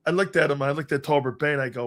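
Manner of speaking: ordinary talk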